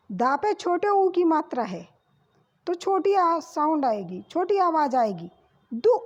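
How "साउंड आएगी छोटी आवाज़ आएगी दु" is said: ordinary talk, nothing marked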